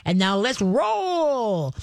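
high, slow, giddy sing-song; excited dog voice. Retold or imitated. Trainer voices what praise means to a dog, giddy high sing-song. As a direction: stretch it out like a thrilled dog.

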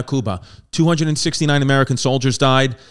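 normal talking voice

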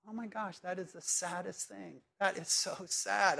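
hushed, tender dismay, slowing at the end; softly aching with sympathy; saddened sympathy. Acted. Preacher voices someone moved by the dog at the grave, softly saddened.

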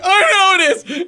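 high, loud exasperated reply; frantic yell. Retold or imitated. Dad answers crying son in bath, frantic yell. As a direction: yell it back in a panic.

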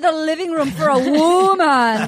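hammed-up retold refusal; indignant man. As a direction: proclaim it like an affronted suitor. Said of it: "and then he goes".

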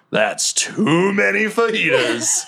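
high, drawn-out comic punchline; hammed-up Greenbeard. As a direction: swoop up and stretch it out with mock gravity. Greenbeard answers joke, hammed up.

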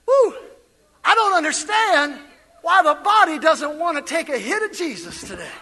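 ordinary talk, nothing marked

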